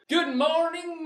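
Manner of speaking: high, bright sing-song good morning; sing-song greeting. Real, not acted. Host calling out good morning to his viewers, sing-song high. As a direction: stretch it up high like a cheerful host.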